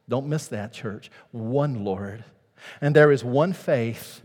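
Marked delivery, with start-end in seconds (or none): none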